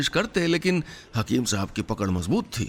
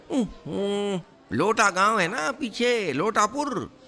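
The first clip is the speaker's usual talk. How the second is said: much higher, fumbling compounder inventing a story; flustered cover-up; 9 semitones higher than usual. Acted. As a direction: squeak out a hasty cover story, ending in a laugh. Nankau covers the lie. (घबराया compounder, ऊंची आवाज़ में बहाना गढ़ता)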